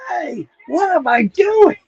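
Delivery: high-pitched cartoon double take; sudden alarm. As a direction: voice jumps up in mock alarm. Bugs suddenly realizes his mistake.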